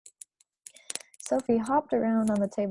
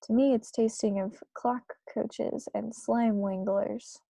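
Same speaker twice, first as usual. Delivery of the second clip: ordinary delivery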